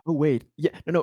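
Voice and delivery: ordinary talk, nothing marked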